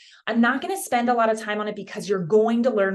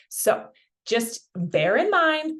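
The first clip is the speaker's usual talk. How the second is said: ordinary delivery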